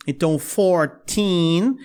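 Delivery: put-on fourteen slow with teen stressed; slowly, hitting the teen. Shown as a demonstration. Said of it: "A sílaba tônica é mais o teen". (fourteen devagar, tônica no teen)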